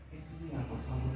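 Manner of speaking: soft, quiet asking tone, a polite plea; gentle request. Shown as a demonstration. Teacher asking a student softly, gentle request. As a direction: ask it kindly, almost pleading. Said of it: "es un pedido".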